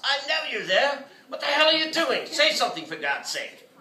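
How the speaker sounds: high, loud exasperated shout; angry yelling. A narrator's reading. Gallery owner yelling at unresponsive artist, angry. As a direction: demand an answer, furious. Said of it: "yelled Wilcox".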